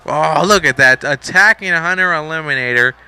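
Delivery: loud play-by-play protest; indignant commentary. Pretend. Commentator sees Hunter Eliminator attacked, loud and indignant. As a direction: call the attack loudly, as an outraged commentator.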